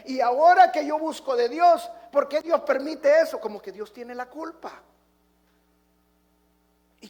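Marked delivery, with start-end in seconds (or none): 0.1-3.4 desperate king. Retold or imitated. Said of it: "salen como el rey de Israel".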